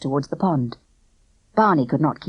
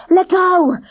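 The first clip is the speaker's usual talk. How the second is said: loud, high panting cry; panted; 13 semitones higher, 8 dB louder than usual. A narrator's reading. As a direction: gasp it out while running. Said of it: "Barney panted".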